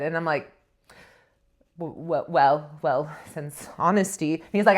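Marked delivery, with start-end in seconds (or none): 1.8-4.3 nervous stammer. Retold or imitated. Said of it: "I'm nervous".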